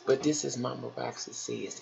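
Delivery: ordinary talk, nothing marked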